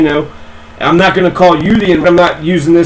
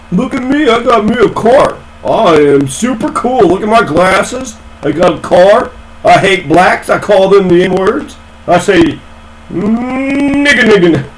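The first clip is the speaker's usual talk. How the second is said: mocking dork voice; 3 semitones higher than usual. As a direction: play him as a smug dorky braggart, hammed up. Mocking the dork's voice.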